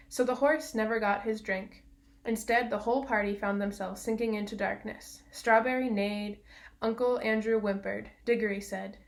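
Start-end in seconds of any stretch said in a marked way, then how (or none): none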